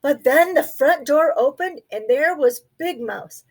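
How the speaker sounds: usual speaking voice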